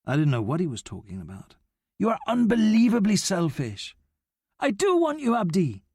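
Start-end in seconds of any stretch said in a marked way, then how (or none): 2.0-4.0 accusing snap. A narrator's reading. Abdi accusing Noah of selfishness, higher and sharp. 4.6-5.8 higher, desperate insistence on do; earnest yell. A narrator's reading. Noah yelling to Abdi that he wants him, higher and urgent. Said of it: "I yelled it because I meant it so much".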